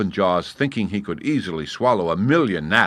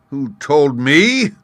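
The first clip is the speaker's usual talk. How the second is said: loud, high, slow roar; roaring lion; 8 semitones higher, 10 dB louder, slower than usual. A narrator's reading. Lion roaring at the gnat's challenge. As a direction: bellow the question back. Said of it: "roared the lion".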